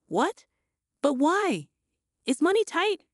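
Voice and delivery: rising, puzzled questions. A narrator's reading. Daughter learning mother has no food, puzzled. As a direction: sound baffled about the money. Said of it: "I asked, puzzled".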